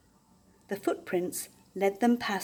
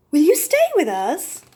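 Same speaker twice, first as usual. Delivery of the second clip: hopeful plea; 8 semitones higher, 8 dB louder than usual. Acted. Basil asks after deep breath.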